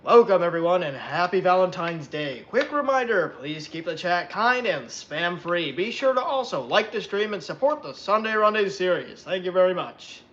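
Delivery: a mock-formal bot voice welcoming the chat; Trainstorm's moderator voice. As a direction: read it out like an automated mod bot message. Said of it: "Let me do it in the voice".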